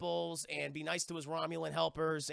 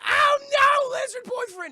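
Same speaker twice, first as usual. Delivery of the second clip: very high, loud mock shriek; imitating a girlfriend screaming in horror; hammed-up horrified scream; 16 dB louder, slower than usual. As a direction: wail it out in exaggerated panic. Acting her scream at boyfriend's death.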